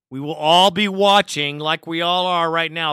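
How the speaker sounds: ordinary talk, nothing marked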